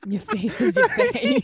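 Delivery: high, loud gangster pickup line; hammed-up street tough. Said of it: "You turned all, like, gangster".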